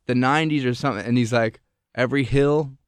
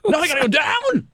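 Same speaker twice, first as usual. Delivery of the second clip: high-pitched panicky wail; mock terror. Imagining the producer facing the steep descent, mock terror. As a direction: cry it out in hammed-up fright. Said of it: "he was as scared shitless".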